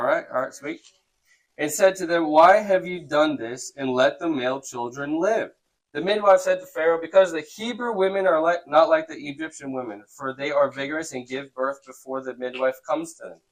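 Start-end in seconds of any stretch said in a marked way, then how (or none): 1.6-13.4 emphatic read-aloud, lightly voiced quotes; scripture reading. A narrator's reading. Teacher reads midwives answering Pharaoh, emphatic reading. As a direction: read out question and answer clearly.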